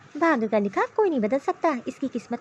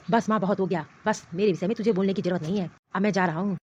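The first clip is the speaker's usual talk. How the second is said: lower, menacing voice of a furious husband; a possessive man fuming and threatening; angry husband; 8 semitones lower than usual. Acted. Husband rages to mother that his wife left without asking.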